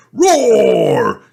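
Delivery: sudden high, loud, long roar; big falling roar; toy dinosaur roar. Acted. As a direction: burst out roaring like a toy come alive. Toy suddenly comes to life.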